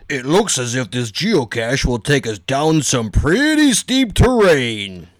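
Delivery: slow, drawn-out dad. Acted. Dad sizing up the trail, slow dad voice. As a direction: stretch it out like a ponderous father. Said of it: "Dad said".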